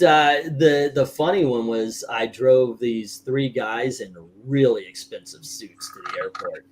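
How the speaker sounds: ordinary talk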